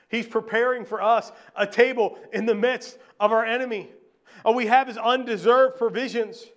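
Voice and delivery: high, loud preaching to the congregation; full-force preaching. Acted. Pastor proclaiming to the congregation, loud and lifted. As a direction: preach it out with conviction.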